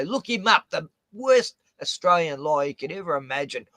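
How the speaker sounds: ordinary talk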